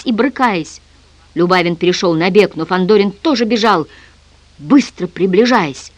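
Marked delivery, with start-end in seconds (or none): none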